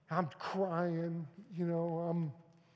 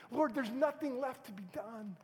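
higher, louder ringing sermon line; preaching climax; 8 semitones higher, 5 dB louder than usual. Acted. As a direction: lift it up to the Lord.

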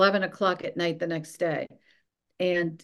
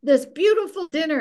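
high-pitched imitation of an irate yell; mock-yell as the outraged boss; retold screaming; 10 semitones higher, 4 dB louder than usual. Boss yells about his interrupted dinner, retold screaming. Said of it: "you know, screaming".

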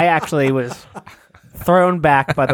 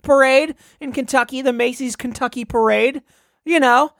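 higher, excited radio announcer, climbing much higher at the floats; parade reporter voice, rising; 10 semitones higher than usual. Acted. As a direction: get more and more worked up pointing at the floats. Continues reporter bit.

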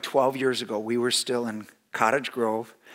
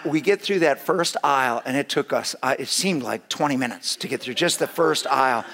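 ordinary talk, nothing marked

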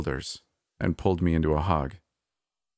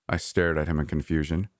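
usual speaking voice

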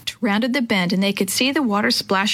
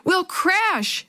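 understated yell at a higher pitch; mild yell; 10 semitones higher than usual. A narrator's reading. Girl warns the others on the raft of a crash, mild high yell. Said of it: "Maria yelled".

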